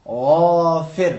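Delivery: very slow غافر with a gargling ghain; teacher slowly models the gargled ghain. Shown as a demonstration. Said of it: "کس طرح ادا کریں گے".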